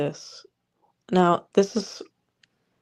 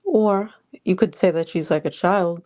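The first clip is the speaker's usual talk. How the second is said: normal talking voice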